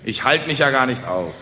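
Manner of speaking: ordinary delivery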